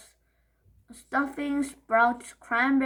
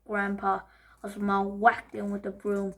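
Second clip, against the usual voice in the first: ordinary delivery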